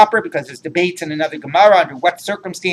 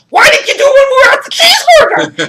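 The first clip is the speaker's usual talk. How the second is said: much higher, louder and fast, cracking into laughter; outraged protest; 7 dB louder, faster than usual. Retold or imitated. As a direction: shout the punchline in mock outrage. Punchline, friend's outraged protest.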